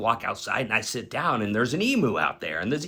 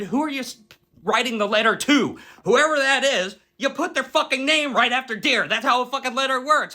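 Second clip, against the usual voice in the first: much higher, louder exasperated explaining; exasperated lecture; 11 semitones higher, 4 dB louder than usual. Retold or imitated. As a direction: spell it out to her, fed up. Lecturing Pauletta on letters.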